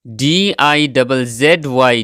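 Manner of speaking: slow letter-by-letter spelling; spelling aloud for learners; spelled out. Shown as a demonstration. Spelling out the word.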